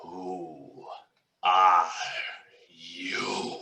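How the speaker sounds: slow, menacing dragon. Acted. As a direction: loom over them as a dragon.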